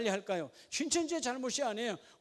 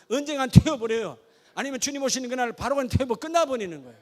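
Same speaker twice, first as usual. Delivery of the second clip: loud, emphatic warning; forceful preaching; 8 dB louder than usual. Real, not acted. Preacher warning the congregation of judgment, loud and forceful. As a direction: hammer it out at full preaching volume.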